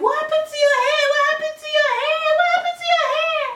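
very high, fast, loud shrieked questions; squealing, rapid-fire pestering; mock schoolmates. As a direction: imitate a crowd of shocked schoolgirls.

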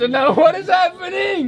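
singsong cartoon Italian accent, high. Shown as a demonstration. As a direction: exclaim in a mock Italian accent. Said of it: "I speak, uh, with an Italian accent".

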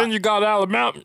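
high-pitched mock Alabama accent. Shown as a demonstration. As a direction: put on an American Southern country twang. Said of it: "It's not Alabama, but it's like".

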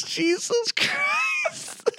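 high shout. Real, not acted. Outraged at surprise reveal.

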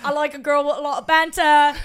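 hammed-up Essex reality-show contestant; Essex accent. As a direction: introduce yourself in a bad Essex accent. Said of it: "like, this horrible accent".

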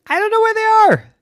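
very high, loud fake innocence; innocent piglet. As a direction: play dumb like a little pig. Pig denies to farmer.

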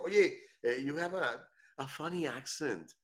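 voz aguda de quien se mete con otro; burlón que critica. Acted. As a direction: imita a un burlón, más agudo y alargando el final. Imita a quien critica el acento. (burlón, con voz aguda)

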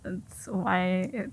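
muffled through a mask; through a face mask. Real, not acted. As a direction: speak from behind a face mask. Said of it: "I have a mask on my face today".

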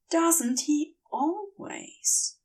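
slow, soft, dreamy repeat; dreamy echo. A narrator's reading. Narrator echoes the thought slowly and dreamily. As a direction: let it drift, lingering.

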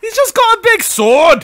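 put-on very high, slow Scottish accent. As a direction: laugh through a Scottish shout. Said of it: "Kai's Scottish accent".

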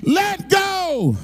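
loud, rising sermon build; full-force preaching. Acted. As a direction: preach it to the back row.

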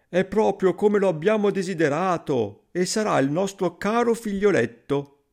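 high, pleased storybook parent voice; delighted parents. A narrator's reading. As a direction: welcome the longed-for child, pitched high. Parents welcome the tiny child. (genitori contenti, voce acuta)

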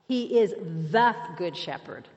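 "the" said long as THEE or THUH, set apart; stress on "the". Shown as a demonstration. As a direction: hammer the word "the" before good shepherd. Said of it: "I want to emphasize the word "the".

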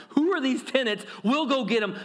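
rally the crowd to go get them; outraged crowd. Retold or imitated. Angry crowd volunteering to Jesus, lightly hammed. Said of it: "They would have been asking, like".

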